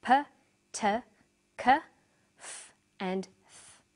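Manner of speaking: isolated "P, t, k, f, th", each without voice and separated by pauses; voiceless consonant sounds. Shown as a demonstration. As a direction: say "P, t, k, f, th" as sounds, not letter names. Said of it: "after voiceless consonants".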